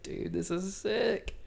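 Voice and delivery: a high, slow, amazed "dude". Retold or imitated. He marvels at the salesman's lookalike, high and slow. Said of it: "I was like".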